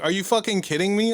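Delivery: can't believe what he's hearing; exasperated disbelief. Retold or imitated. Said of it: "I was so mad".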